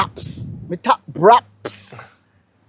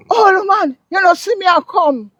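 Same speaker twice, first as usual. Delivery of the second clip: taxi man's excuse in a much higher, lighter voice; imitating the taxi man; 11 semitones higher than usual. Guest mimics the taxi driver who cut her off, high-pitched. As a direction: lift the voice high to mock the driver. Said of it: "he actually say".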